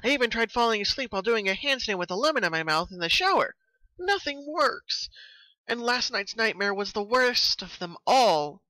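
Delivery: Pinkie pony voice. A narrator's reading. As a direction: play Pinkie venting about her sleepless nights.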